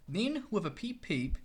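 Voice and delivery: ordinary delivery, nothing marked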